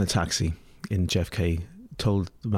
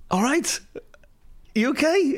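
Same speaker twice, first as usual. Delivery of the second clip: chirpy greeting. Retold or imitated. Cheerful greeting to thug.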